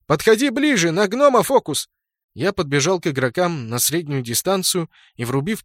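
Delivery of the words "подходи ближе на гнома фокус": higher, louder command call; shout. A narrator's reading. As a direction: yell orders to a teammate. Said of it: "крикнул я".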